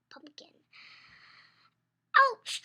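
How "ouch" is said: sharp loud ouch, pitched high; cry of pain. Acted. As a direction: yelp as grandpa falls. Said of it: "cried Abuelo".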